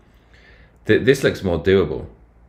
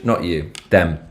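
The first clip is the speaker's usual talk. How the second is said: ordinary talk, nothing marked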